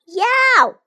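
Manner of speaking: sudden high, loud yelp; sharp startled cry. A narrator's reading. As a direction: yelp as if burnt by hot porridge. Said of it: "she yelped".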